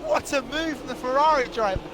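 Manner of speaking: high excited shout; motorsport commentator. Real, not acted. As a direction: yell the overtake live. Overtake shouted at high pitch.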